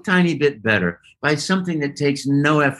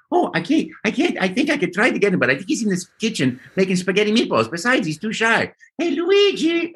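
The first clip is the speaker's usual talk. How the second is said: high, fast Mario voice; chirpy Italian plumber chatter; 8 semitones higher, faster than usual. Retold or imitated. Mario answering a child.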